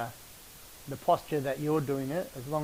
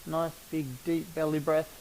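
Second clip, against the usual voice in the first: guided breathing; 7 dB quieter than usual. Acted.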